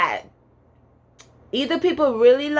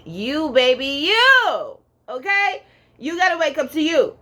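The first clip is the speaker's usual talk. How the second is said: excited; 7 semitones higher than usual. Real, not acted. Hyping up the viewer.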